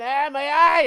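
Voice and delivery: high, very loud mock wail; loud re-enacted yelp of agony; retold cry of pain. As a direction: cry out like an injured kid. Acting himself hurt as a kid.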